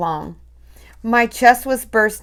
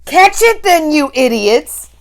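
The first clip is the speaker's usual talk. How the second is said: high, loud furious witch scream; Grand High Witch screaming at her witches; 8 semitones higher, 8 dB louder than usual. A narrator's reading. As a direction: scream at your useless minions. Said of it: "screamed the Grand High Witch".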